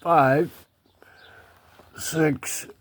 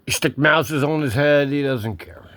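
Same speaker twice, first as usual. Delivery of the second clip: usual speaking voice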